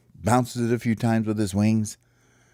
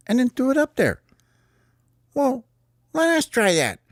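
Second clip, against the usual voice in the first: much higher, eager bird chirp. Acted. Sparrows beg to try the trick themselves, high chirp. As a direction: chirp it like a keen little bird.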